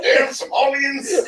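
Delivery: mock outcry. Mock outburst in pirate banter.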